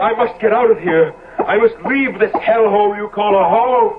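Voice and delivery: high, rising panic; desperate to get out, voice climbing; panicked flight. Acted. Basil fleeing the house.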